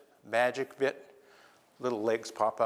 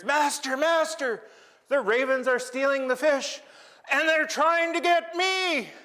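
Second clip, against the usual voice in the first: very high, loud, frantic squeak; panicked little creature; 12 semitones higher, 5 dB louder than usual. Acted. Eye creature screams to Raven as ravens attack, high panicked squeak. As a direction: shriek for your master in panic.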